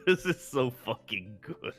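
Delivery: ordinary delivery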